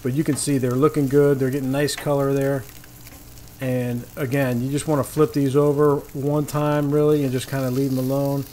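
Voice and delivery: usual speaking voice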